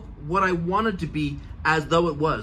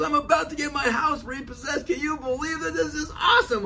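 higher, giddy delight at bad news; mock hysterical glee, much higher; giddy excitement; 10 semitones higher than usual. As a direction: sound thrilled about disaster. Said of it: "He's just, like, hysterical".

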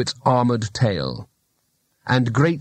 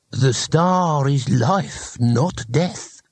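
very slow, crackly, distant voice; crackly and far away; much slower than usual. Acted. Rincewind's voice taken over by the spell, crackly and far away. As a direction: let the spell speak through him, slow and far off. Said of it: "Your voice went all crackly and far away".

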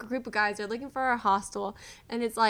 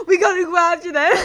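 fast, loud, high battle cry; hammed-up shout to give chase; urgent rallying yell; 7 semitones higher, 11 dB louder, faster than usual.

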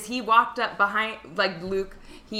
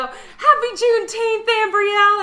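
high, slow, louder sing-song greeting; imitating the coworker greeting a colleague, high and drawn out; cheery coworker greeting; 12 semitones higher, 7 dB louder than usual. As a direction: stretch it out high and chirpy. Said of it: "walk right down the line to Ambrielle and go".

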